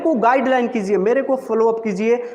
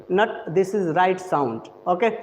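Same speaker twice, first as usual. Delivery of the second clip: ordinary delivery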